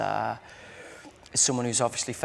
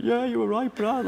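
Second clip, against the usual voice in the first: teary admission; 10 semitones higher than usual. Retold or imitated. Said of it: "I had tears in my eyes".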